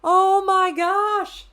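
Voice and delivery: slow high exasperated oh my gosh; slow stunned groan; drawn-out dismay. Real, not acted. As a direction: stretch it out in disbelief. Reacting to unwanted roll.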